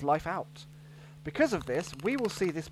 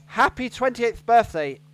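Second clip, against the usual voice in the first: louder showy horoscope read; horoscope reader; 4 semitones higher, 6 dB louder than usual. Pretend. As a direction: read it like a tabloid psychic. Reading psychic horoscope.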